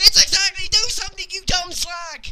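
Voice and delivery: high, loud, fed-up yelling; exasperated shout. Real, not acted. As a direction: shout it out in frustration. Ranting at Dixie, shouting.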